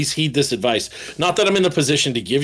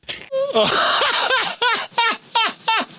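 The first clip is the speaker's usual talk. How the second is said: big high laugh. Real, not acted. Laughing at his own story.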